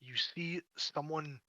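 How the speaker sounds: ordinary delivery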